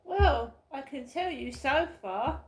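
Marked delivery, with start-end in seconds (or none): 0.0-0.5 sudden loud high well; loud exasperated well. Real, not acted. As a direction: burst out with it. Reacting to mother's message.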